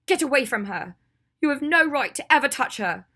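high, very loud outburst of loathing; furious shout. Acted. Ginny lunges at Draco to protect her friend, shouting furiously. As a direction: explode at him to protect your friend.

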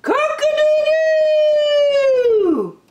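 very high, loud, long crow; piercingly high, drawn-out rooster call; rooster's crow. Acted. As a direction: crow like a rooster, holding the last note. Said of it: "crowed the rooster".

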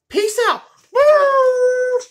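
high, loud yell of peace out; shouted sign-off. Real, not acted. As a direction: belt the goodbye out. Vlog sign-off.

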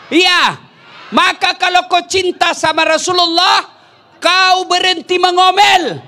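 high, loud shouted sermon; fiery preaching. Acted. Preacher rebuking the wives about nagging, fiery shouted preaching. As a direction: hammer the point home to the congregation.

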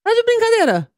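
can't believe what she just heard; disbelief. Real, not acted. Host disbelieves guest never stopped.

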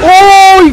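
long, high, loud "ay" yelp; startled yell. Real, not acted. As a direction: yelp at full volume, very high. Startled by attack in game. (grito agudo de susto)

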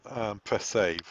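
normal talking voice

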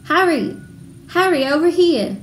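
high, loud call across a street. Acted. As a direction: hail a friend from afar, excitedly. Girl calls across the street.

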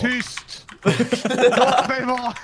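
high, drawn-out mock plea; mock protest. Player telling noisy teammates off, in hammed-up protest. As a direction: playfully cry out, stretching the words.

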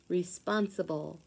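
ordinary delivery, nothing marked

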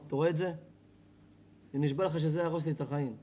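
low, soft, earnest fatherly plea; sincere confession. Shown as a demonstration. Smoking father confiding in his son, sincere and subdued. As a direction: admit your own weakness gently, keeping it low. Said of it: "לא, לא ככה".

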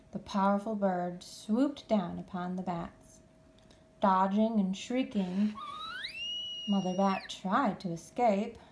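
plain reading voice

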